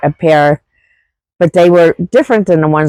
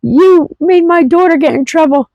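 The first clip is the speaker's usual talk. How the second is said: imitating her friend's mother; 12 semitones higher than usual. Friend's late mother apologizing to her, much higher put-on voice. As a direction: play the mother making her apology, pitched way up. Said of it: "And she says".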